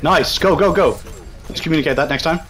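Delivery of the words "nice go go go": shouted callout. Real, not acted. Calling team to push.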